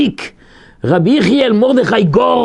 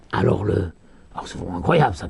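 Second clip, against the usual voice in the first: usual speaking voice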